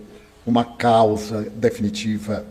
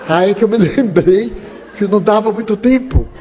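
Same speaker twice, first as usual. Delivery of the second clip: laughing; 7 semitones higher, 5 dB louder than usual. Real, not acted. Laughs at his own story.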